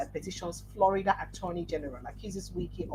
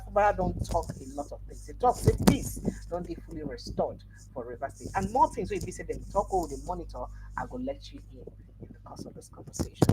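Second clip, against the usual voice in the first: usual speaking voice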